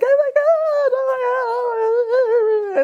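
very high, gushing greeting; excited reunion squeal. Retold or imitated. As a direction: squeal as if reuniting with long-missed friends. Acting out excited yearly reunion greetings.

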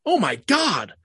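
high, slow dramatic gasp; mock overreaction. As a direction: ham up the shocked sports fan. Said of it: "I've reacted dumb".

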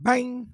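high, loud mock bang; bang sound. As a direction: smack out the impact. Imitating ball impact.

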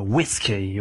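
"whisky" in an English accent. Shown as a demonstration. Speaker showing foreign mode sounds odd, English accent. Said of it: "si no te vas a poner en modo".

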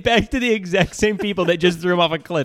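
laughing through the words; breaking into laughter while talking. Real, not acted. Laughing retelling the absurd rescue.

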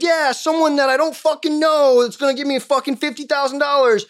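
high mock-excited gloating; mocking lottery player. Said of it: "you'll be like".

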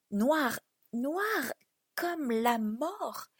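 very slow, drawn-out words; ominous, slowly stretched narration; ominous dread. A narrator's reading. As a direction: let each word hang, dark and drawn out. Dread at the dark deserted streets.

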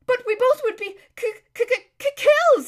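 high, loud stuttering panic; terrified stammer. Acted. Lazareline realising they would be killed, shrieky stammering fright. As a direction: stick on the first sound, then squeal the word out.

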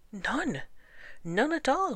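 high, emphatic denial; iguana's cry. A narrator's reading. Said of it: "cried the iguana".

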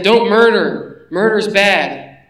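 each word laid down slowly and firmly; slow, stern pronouncement; slow emphatic command. Acted. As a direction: hand down the law, deliberate and weighty. Preacher quoting the law emphatically.

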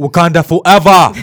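loud, high, proud battle cry; Wakanda forever shout. Acted. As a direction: bellow it with conviction. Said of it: "I've been waiting to do that".

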